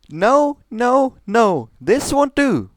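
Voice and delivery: high, loud royal shout; furious princess yelling no. Acted. Celestia furious that her sister gains too slowly, shouting. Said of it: "her sister shouted".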